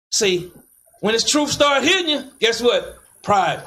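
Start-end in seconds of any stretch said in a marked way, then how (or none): none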